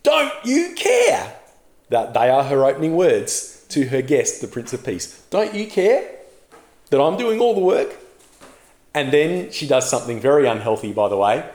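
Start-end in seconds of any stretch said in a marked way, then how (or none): 0.0-1.3 high, slow, accusing cry; indignant outburst. Acted. Sister storming in on her guest, indignant outburst. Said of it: "She storms in and says". 5.3-8.0 higher, mock exasperated demand; indignant complaint. Martha complaining to Jesus about the work, mock exasperated. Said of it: "yelling at him".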